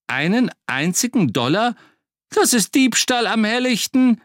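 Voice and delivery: high, loud indignant cry; outraged. Acted. Butcher outraged at price.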